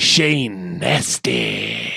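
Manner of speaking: low, slow ring announcer. Acted. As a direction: draw the name out low and slow. AKA nickname introduction.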